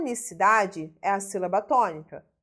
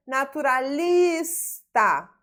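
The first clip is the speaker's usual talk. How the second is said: "Naturalista" with a long LIS; stressed syllable demonstration; 3 semitones higher, 4 dB louder, much slower than usual. Shown as a demonstration. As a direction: slowly draw out LIS in "Naturalista". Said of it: "sílaba tônica".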